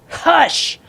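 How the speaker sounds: high, loud sharp yell; yelled hush. A narrator's reading. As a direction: cut everyone off with a shout. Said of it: "Bailey yelled".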